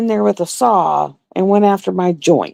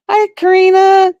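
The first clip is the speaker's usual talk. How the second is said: excited greeting; 11 semitones higher, 5 dB louder than usual. Real, not acted. Greeting a viewer by name.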